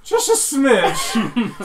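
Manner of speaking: slow, high, laughing tease; teasing. Acted. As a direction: drag it out with a sly laugh. Teasing metagaming.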